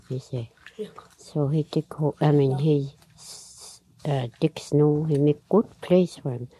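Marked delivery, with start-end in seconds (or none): none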